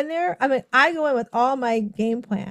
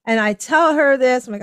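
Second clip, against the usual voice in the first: usual speaking voice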